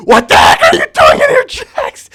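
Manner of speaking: loud, rising angry shout; shouting confrontation. Acted. Zubul yelling at Jax caught in the music room. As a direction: yell at him to explain.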